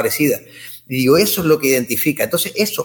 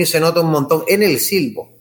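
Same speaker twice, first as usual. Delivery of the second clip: ordinary talk, nothing marked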